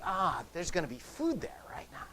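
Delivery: breathy whisper of a hungry thought. Retold or imitated. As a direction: whisper it like a hunter spotting food. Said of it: "we think".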